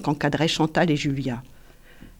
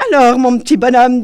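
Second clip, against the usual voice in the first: higher, louder patronising coo; teasing adult; 6 semitones higher, 11 dB louder than usual. A narrator's reading. Julien pinching Valentin's cheek, loud teasing. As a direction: talk down to him fondly.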